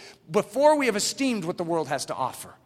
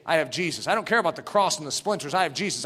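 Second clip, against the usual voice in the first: usual speaking voice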